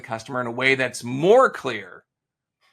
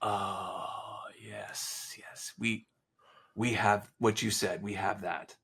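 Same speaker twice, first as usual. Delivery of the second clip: client conceding quietly; 5 semitones lower, 7 dB quieter, much slower than usual. Retold or imitated. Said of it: "And they're like".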